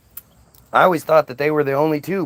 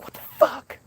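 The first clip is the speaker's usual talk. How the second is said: whispered outrage; 5 dB quieter than usual. Retold or imitated. Eve reacts to blame.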